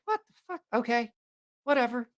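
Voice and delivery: high, disbelieving what-the; mock shocked bartender in a joke; stunned bartender.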